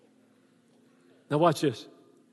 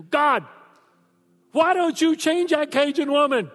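much higher and louder, pleading whine; husband griping to God about his wife, high pleading whine; complaining to God; 11 semitones higher, 7 dB louder than usual. Retold or imitated. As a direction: whine up at heaven like a frustrated husband. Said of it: "I go".

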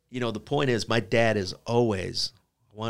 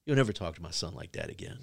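low, soft imitation of a protective dad; quiet warning; 4 semitones lower, 8 dB quieter, faster than usual. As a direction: warn him without raising your voice. Dad warns teacher, retold.

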